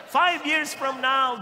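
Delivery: five years from now, called out slow and loud; rally the hall with a slow loud call; slow preacher shout. Acted. Preacher to congregation, a slow loud rallying call.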